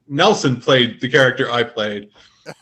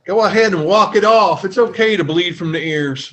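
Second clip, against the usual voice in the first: higher-pitched backwoods scoutmaster; Deliverance-style backwoods guy; 5 semitones higher than usual. Acted. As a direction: play a dim-witted hillbilly character, raised in pitch. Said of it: "Which sounds like a Deliverance guy".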